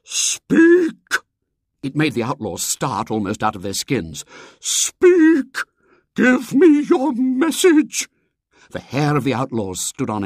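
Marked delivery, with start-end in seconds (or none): 0.0-0.9 booming, high call from the stairs; loud booming call. A narrator's reading. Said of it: "a loud and vibrant voice called". 4.6-8.1 slow, booming high demand; loud booming call. A narrator's reading. Mysterious voice calls from stairs.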